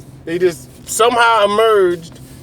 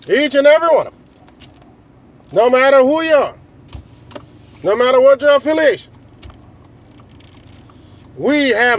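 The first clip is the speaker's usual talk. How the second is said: raised, measured speechmaking with pauses; rally the nation phrase by phrase; lifted orator's cadence, pausing between phrases; rallying political speech; 4 semitones higher than usual. Acted. Plays a president's rallying speech.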